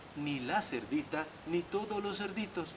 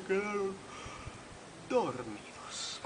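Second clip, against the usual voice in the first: sleepy ending; 3 semitones higher, 6 dB quieter than usual. A narrator's reading. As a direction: let the word sink drowsily into sleep. Animals fall asleep exhausted.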